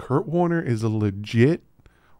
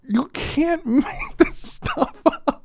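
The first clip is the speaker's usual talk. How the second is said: high, slow, laughing; amused disbelief; slower than usual. Real, not acted. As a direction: say it through laughter.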